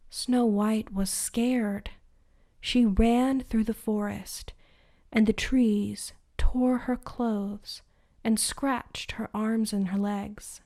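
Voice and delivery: plain reading voice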